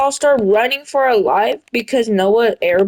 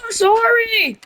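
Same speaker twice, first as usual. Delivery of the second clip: high whining sorry; mock whiny apology; 12 semitones higher than usual. Noah apologising to the group, high mock whine. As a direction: whine it like a caught kid. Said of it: "And Noah's like".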